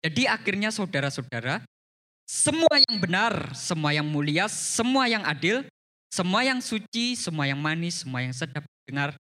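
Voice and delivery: loud, rhythmic listing from the verse; scripture reading. A narrator's reading. Preacher reads a Bible list to the congregation, emphatic and loud. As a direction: proclaim each phrase, stretching the words, then ease off.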